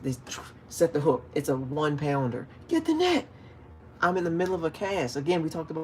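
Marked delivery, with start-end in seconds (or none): 2.7-3.3 high yelled command from the boater; mock yell. Boater yelling at his co-angler, high mock yell. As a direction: bark it like an impatient boater.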